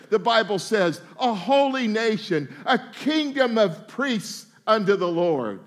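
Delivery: ordinary talk, nothing marked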